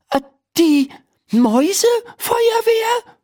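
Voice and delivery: high, halting bafflement; stammering. Acted. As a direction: falter over it, incredulous and high-pitched. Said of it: "stammelt Laura". (ungläubiges, hohes Stammeln)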